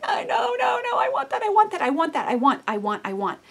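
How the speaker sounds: put-on high wailing toddler protest, half laughing; whining toddler. Soul crying out like a toddler for its comfort, high whine. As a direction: wail like a child denied its bottle. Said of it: "our souls are crying".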